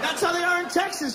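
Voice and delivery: usual speaking voice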